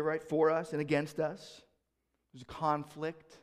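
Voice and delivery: ordinary talk, nothing marked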